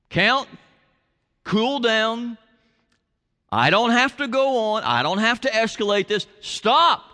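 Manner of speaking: self-talk stop. Shown as a demonstration. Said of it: "It's a signal to say".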